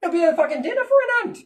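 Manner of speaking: fast, high, mocking jeer; mocking leader. World leader mocking the god as ant food. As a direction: sneer it out quickly.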